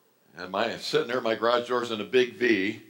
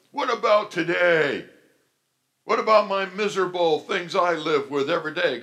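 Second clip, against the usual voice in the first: high, louder whiny complaint; mocking skeptic; 7 semitones higher, 5 dB louder than usual. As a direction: whine like a doubter. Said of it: "some of you are like".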